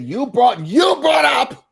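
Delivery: high-pitched mock shout, rising on the repeat; retold confrontation. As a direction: escalate it like quoting someone squaring up for a fight. Retold quarrel between two women.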